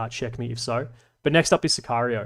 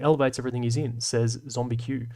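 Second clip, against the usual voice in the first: ordinary talk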